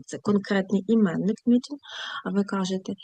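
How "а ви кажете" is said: ordinary talk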